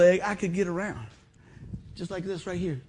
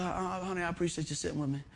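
soft to wife; 9 dB quieter than usual. Retold or imitated. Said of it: "I said".

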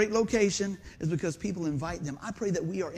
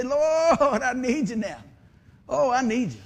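desperate plea; 5 semitones higher, 6 dB louder, slower than usual. Retold or imitated. Mock prayer from the ditch.

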